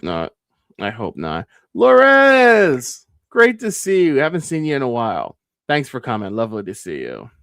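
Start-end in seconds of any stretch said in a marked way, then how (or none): 1.8-5.4 loud, much higher, cheerful hail; excited greeting. Real, not acted. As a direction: call out a big welcome. Loudly greeting a newcomer.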